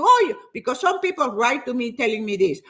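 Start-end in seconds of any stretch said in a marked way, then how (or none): 0.0-0.4 higher, louder mock exclamation; mock objection. Trainer brushing off an older client, mock objection. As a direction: put on a discouraging coach's objection. Voicing a discouraging coach.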